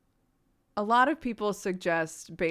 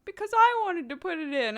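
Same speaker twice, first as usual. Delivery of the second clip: put-on toddler reply; 11 semitones higher, 4 dB louder than usual. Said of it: "And she was like".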